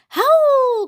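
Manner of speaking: high, loud howling call; cried out. A narrator's reading. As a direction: belt it like a werewolf mother. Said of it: "cried the ma".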